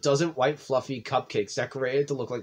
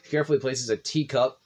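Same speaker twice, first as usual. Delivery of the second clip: ordinary talk, nothing marked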